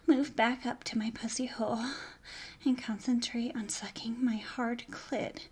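breathy, unvoiced sultry whisper; seductive whisper. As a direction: whisper it close to his ear.

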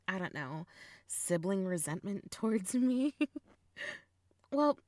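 usual speaking voice

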